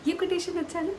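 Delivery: light amused laugh through the words; chuckling, pleased with her line. Real, not acted. A vlogger laughing at her own fridge quote.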